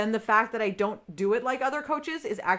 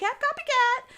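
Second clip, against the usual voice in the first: squeaky copycat; slower than usual. Acted. Playful high repeat of topic word.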